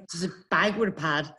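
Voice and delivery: stuttered, juddering words; imitated nervous chatter; teeth chattering. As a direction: push it out through a mock shaking jaw. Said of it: "my jaw just starts shaking".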